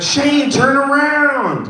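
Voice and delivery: high, long drawn-out call; imitated teammates' drawn-out holler; calling out across the field. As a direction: stretch the words out high, hollering to a distant player. Teammates calling to him in outfield.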